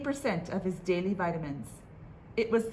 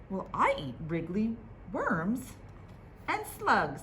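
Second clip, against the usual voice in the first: bird voice. Acted.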